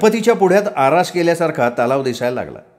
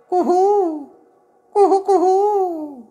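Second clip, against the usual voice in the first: falsetto cuckoo hoot, drawn out; cuckoo call imitation; much slower than usual. A narrator's reading. Doctor shaking a tree and calling, falsetto cuckoo. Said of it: "doctor कोकिळ झाड हलवत ओरडायला लागले".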